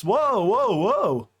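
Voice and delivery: high drawn whoa; rising startled whoas; high mock-startled whoa; mock alarm. Real, not acted. Reacting to chat hating British.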